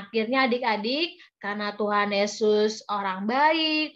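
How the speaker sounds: ordinary delivery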